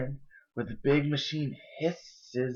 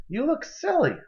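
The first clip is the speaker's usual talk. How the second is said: embarrassed scolding; 13 semitones higher than usual. Acted. Julie scolds Ian at the wall.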